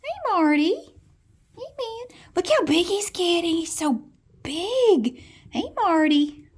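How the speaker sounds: high, loud coo at the pet; pet talk. Real, not acted. Greeting pet Marty, high cooing pet talk.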